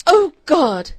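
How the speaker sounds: loud, high despairing cry; anguished cry. A narrator's reading. Girl alone in her locked room, anguished cry. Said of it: "she cried".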